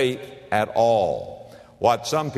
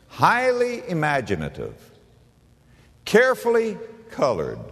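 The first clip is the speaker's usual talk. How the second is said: "Highly imaginative, carefully colored" in two separated phrases, pitched up; pointed repetition; 5 semitones higher than usual. Real, not acted. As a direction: lift "carefully colored" high, with a long pause before it. Preacher stresses quoted phrases by repeating.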